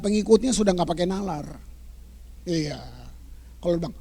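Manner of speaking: usual speaking voice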